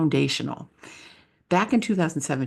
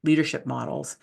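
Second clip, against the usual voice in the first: ordinary delivery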